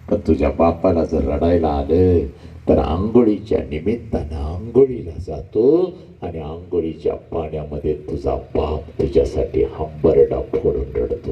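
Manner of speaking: ordinary talk, nothing marked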